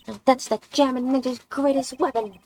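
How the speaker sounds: voice crack. Real, not acted. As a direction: voice breaks while acting excited. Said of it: "Did I just voice crack right now?".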